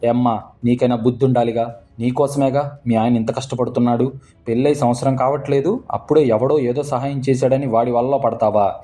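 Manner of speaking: usual speaking voice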